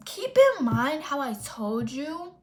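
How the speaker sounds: usual speaking voice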